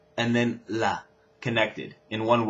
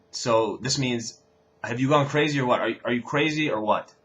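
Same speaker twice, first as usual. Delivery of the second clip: usual speaking voice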